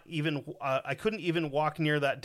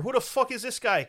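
high, cocky mock Irish accent; hammed-up Irish fighter's challenge, pitched up; Conor McGregor; 8 semitones higher, 4 dB louder than usual. Host mocking people's bad Irish accents, raised Irish impression. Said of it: "they're Conor McGregor".

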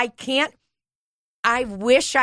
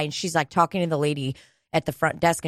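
ordinary talk, nothing marked

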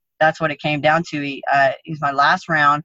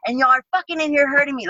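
higher, angry rant at the gym; chewing them out; 9 semitones higher than usual. Retold or imitated. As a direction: tear into your training partners. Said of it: "I'm chewing the guys out".

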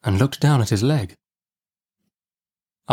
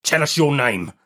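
higher-pitched rough demand; menacing convict; 5 semitones higher than usual. A narrator's reading. Man demanding the seized boy's name, menacing. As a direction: question the boy as a threatening convict.

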